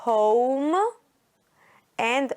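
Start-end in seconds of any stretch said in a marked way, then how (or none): 0.0-0.8 "Home" with a long O, lifting at the end; home with silent E. Shown as a demonstration. Teacher listing magic E words, "Home" with a long rising O. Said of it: "puts pressure on the vowels to say".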